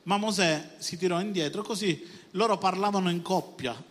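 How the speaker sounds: ordinary talk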